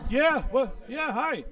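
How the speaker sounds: high, loud flustered greeting; acted-out flustered hello; starstruck fluster. Acts out meeting a rock star.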